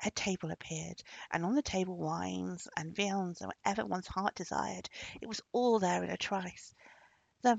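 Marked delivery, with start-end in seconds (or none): none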